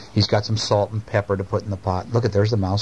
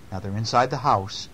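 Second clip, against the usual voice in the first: ordinary delivery, nothing marked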